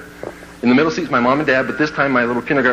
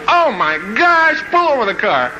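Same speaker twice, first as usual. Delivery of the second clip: panicked mom; 9 semitones higher than usual. Retold or imitated. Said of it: "my mom's looking back going".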